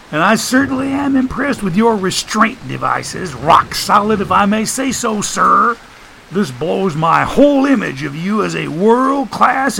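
high, mock-impressed character voice; high-pitched sarcastic praise; sarcastic Joshua. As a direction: needle him in a high, wisecracking voice.

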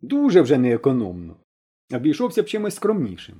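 ordinary delivery, nothing marked

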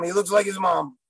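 ordinary talk, nothing marked

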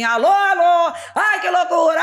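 high, loud megaphone hail; megaphone greeting. Retold or imitated. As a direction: blast it over the crowd. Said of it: "E ela fez assim". (gritando no megafone pela janela)

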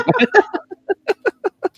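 high laugh. Real, not acted. Laughing at friend story.

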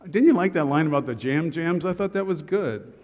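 ordinary talk, nothing marked

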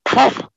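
high, loud poof; sudden magical puff. Acted. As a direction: pop the wish into being. Wish granted sound effect.

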